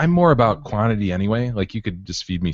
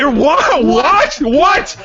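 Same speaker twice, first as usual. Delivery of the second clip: high squeal; 6 dB louder, much slower than usual. Acted. Mock outrage at friend.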